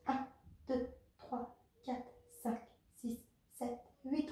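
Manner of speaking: compte les répétitions à voix basse, régulier; égrène les temps doucement, en coach; comptage des répétitions. Acted. Coach counts the exercise reps. (compter les répétitions doucement)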